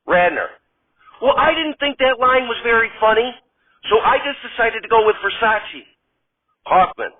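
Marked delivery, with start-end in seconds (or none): 1.2-5.9 put-on much higher, chirpy, girlish voice; sweet Gilda. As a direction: gush like a delighted starlet. Radner defends herself.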